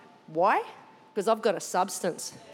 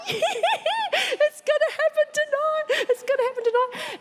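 very high, giddy celebration; acted giddy shriek of joy; ecstatic squeal. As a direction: squeal with uncontainable anticipation. Acts own secret jubilation.

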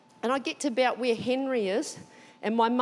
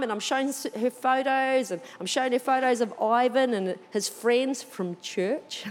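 ordinary delivery

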